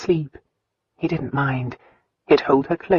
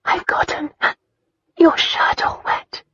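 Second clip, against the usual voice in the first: tearful breathy whisper, much higher; 14 semitones higher than usual. A narrator's reading. Christina, after crying on Lyon's lap, whispering. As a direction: hush it out between hiccups after crying. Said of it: "Christina whispered between hiccups".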